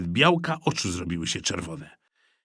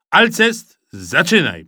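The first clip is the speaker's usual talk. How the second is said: commanding call; 6 semitones higher, 6 dB louder, slower than usual. A narrator's reading. Supervisor orders a boy to start.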